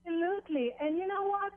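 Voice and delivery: ordinary talk, nothing marked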